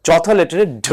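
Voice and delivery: usual speaking voice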